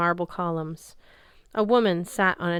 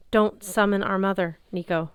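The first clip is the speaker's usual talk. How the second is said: plain reading voice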